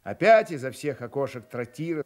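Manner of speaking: ordinary talk, nothing marked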